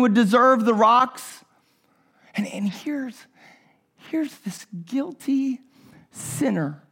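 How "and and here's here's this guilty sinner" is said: hushed whisper. Real, not acted. Preacher to his congregation about the guilty woman, whispered. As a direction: drop to a breathy whisper, slowing on guilty.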